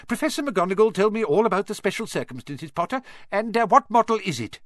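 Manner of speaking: very high squeaky little professor. Acted. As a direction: squeak it out brightly. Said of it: "he squeaked".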